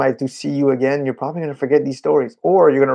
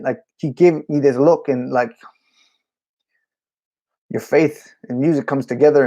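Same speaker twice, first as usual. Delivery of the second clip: usual speaking voice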